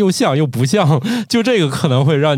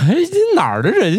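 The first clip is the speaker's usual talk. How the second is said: high-pitched, baffled question; puzzled exclamation; 13 semitones higher, 3 dB louder than usual. Retold or imitated. Listener puzzled by someone's odd Mandarin, baffled exclamation. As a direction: blurt it out, thrown by the odd accent. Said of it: "听着很奇怪".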